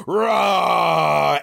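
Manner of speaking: roaring monster growl; deep distorted monster roar. Retold or imitated. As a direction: snarl it out like a monster jumping up. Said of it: "he goes".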